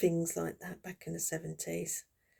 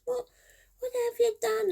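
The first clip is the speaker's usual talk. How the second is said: high, loud horrified question; hammed-up shocked mother, much higher; shocked mum; 5 dB louder than usual. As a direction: gasp it like a mother seeing the haircut. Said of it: "She just".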